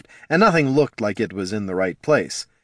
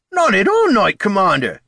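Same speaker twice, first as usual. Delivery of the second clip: high loud outburst; indignant protest; 11 semitones higher, 6 dB louder than usual. A narrator's reading. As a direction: protest his honour sharply. Startled soldier protests.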